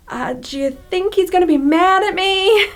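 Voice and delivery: high, hesitant, fretful question; comically nervous, climbing in pitch; worried inner voice. Acted. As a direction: act out fear of being in trouble. Acting Thomas's nervous inner question.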